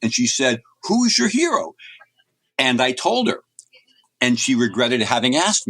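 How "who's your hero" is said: high-pitched, eager question; excited principal. Retold or imitated. Principal thrilled someone understood her question, asks him excitedly. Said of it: "she was so excited".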